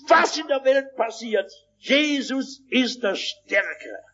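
high-pitched, emphatic pulpit delivery; preaching. Acted. Preaches at full force. (eindringliche, hohe Predigtstimme)

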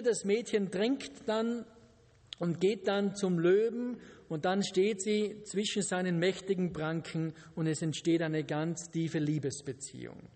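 normal talking voice

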